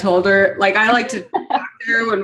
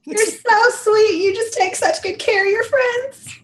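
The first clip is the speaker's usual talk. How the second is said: sweet and gushing; 12 semitones higher than usual. Acted. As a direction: gush over him sweetly. Character praises rescuer.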